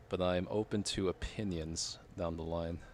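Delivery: ordinary delivery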